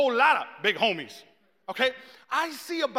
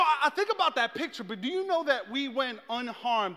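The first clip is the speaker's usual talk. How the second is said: ordinary delivery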